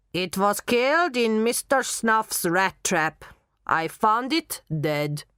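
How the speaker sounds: put-on slow, heavy German accent. Knockman telling how his budgie died, German accent. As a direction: tell it slowly with a German accent. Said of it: "you will talk in a German accent".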